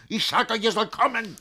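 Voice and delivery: insistent order. Acted. Master ordering the servants to come, high and insistent. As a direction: demand obedience.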